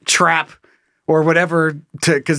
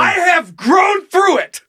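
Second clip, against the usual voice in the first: high, slow, loud declaration; mock earnest shout; 14 semitones higher, 3 dB louder, slower than usual. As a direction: overplay how much you've healed. Mock self-help protest.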